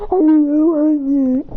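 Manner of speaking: loud, sarcastic hello honey; mock sweet greeting. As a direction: belt it out mockingly into the phone. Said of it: "he goes".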